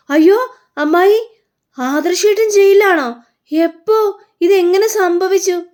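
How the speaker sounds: high, loud, slow put-on astonishment; fake shock. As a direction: ham up the innocent surprise. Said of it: "നിർത്തടാ നിന്റെ അഭിനയം".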